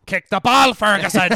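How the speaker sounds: mock touchline yell. Mock fan yelling at Ferguson from the stands, full loud shout.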